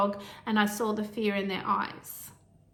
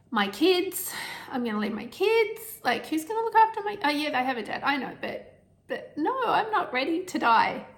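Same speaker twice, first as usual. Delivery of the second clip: higher, voice catching, near crying; emotional, near tears; 6 semitones higher than usual. Real, not acted. Mother facing death, tearfully fighting back crying. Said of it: "I'm getting a bit emotional".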